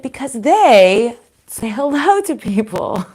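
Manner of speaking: slow, loud, drawn-out obvious answer; exasperated-parent style emphasis, played up; pointed answer. Retold pointed answer to daughter.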